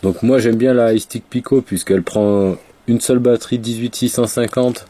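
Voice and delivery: ordinary talk, nothing marked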